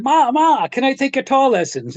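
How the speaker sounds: put-on high-pitched kid pestering a parent; imitated eager child. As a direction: act out an eager eight-year-old asking Mom. Himself as child asking his mother.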